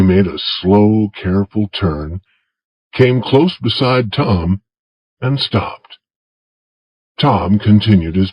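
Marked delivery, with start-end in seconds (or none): none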